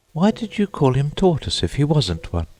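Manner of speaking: put-on high girlish voice for Alice; Alice asking. A narrator's reading. Alice questioning the Mock Turtle, in a high curious voice. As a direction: pitch up for the young girl's question. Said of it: "Alice asked".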